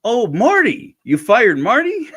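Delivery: high, loud startled question, he has no idea who this is; a man stunned that they fired Marty, shocked pitch jump; baffled surprise. Retold or imitated. Christopher Lloyd hearing the news, shocked and high. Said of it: "And he goes".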